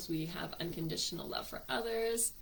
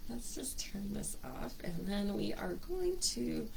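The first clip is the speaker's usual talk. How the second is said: whispered aside; 5 dB quieter than usual. Real, not acted. Aside while fetching music.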